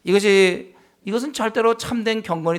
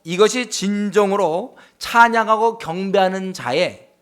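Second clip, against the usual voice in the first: normal talking voice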